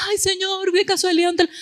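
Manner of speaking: más aguda y más suave, con cortesía nerviosa; tono dulce fingido. Retold or imitated. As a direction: pregunta con delicadeza inquieta. Habla con el encargado. (pregunta tímida al encargado por el teléfono)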